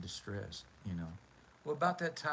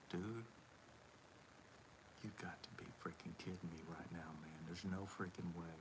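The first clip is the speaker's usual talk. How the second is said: hushed disbelief; 7 semitones lower, 16 dB quieter, faster than usual. Retold or imitated. Whispered disbelief to Coma.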